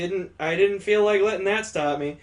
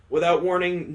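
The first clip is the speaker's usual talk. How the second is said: plain reading voice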